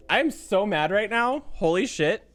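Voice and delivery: ordinary delivery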